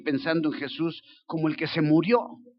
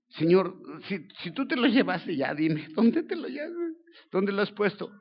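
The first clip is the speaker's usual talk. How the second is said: desperate plea; 5 semitones higher than usual. Acted. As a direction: beg the gardener, growing frantic. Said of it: "O sea, está desesperada ella".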